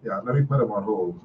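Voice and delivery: ordinary talk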